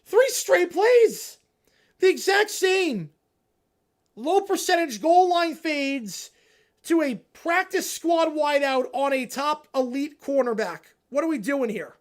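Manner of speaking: loud, slow, emphatic complaint; slow angry rant. Real, not acted. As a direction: spell out every word in frustration. Ranting about play calls.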